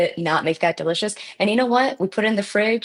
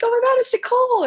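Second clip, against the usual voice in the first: much higher, thrilled squeal of recognition; starstruck excitement; faster than usual. Retold or imitated. Fan spotting a friend's bakes under glass, excited high squeal. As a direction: gasp it out like spotting a celebrity. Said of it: "It was really cool to be like".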